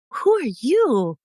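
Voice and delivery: acted bewilderment; mild shock. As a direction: voice a startled teen, drawn out. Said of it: "and she's shocked".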